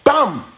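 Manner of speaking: high, sudden "bam"; punch sound. Retold or imitated. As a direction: burst it out like a landed punch. Imitates the sudden punch landing.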